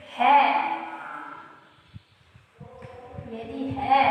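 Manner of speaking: word by word with long pauses for writing; slow dictation. Shown as a demonstration. Teacher dictating a note to students, slow word by word. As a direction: dictate it slowly so students can copy. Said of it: "तो मैं इसके लिए note करके आपको बताती हूँ".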